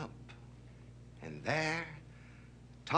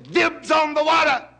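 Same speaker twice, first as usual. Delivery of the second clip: high, loud shout from an excited tiger; shouted claim; 12 dB louder than usual. Acted. Tiger beating the lion to the pool, shouting. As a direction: yell it out to claim it first.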